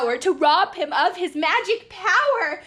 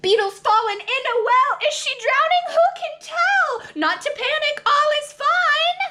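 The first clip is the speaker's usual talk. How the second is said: excited; 12 semitones higher than usual. Acted. Alarm at beetle in well.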